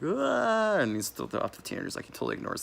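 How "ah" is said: short squealed "Ah" far higher; a quick hammed-up shriek; high mock scream. Diners fleeing Jason, in a high mock shriek. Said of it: "They're like".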